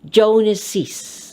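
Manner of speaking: "Joneses" read with an extra syllable after Jones; "Joneses" as two beats, JONE-siz. Shown as a demonstration. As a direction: sound the added -iz ending in "Joneses". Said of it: "by sounding an extra syllable".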